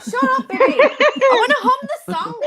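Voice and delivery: high laughing protest. Real, not acted. Laughing protest, wants to hum.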